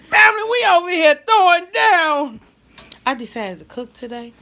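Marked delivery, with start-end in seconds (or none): 0.0-2.4 high, loud laughing call to viewers; high, loud cackle then shout to the viewers. Real, not acted. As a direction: burst out laughing and shout to the family. Laughing to her audience.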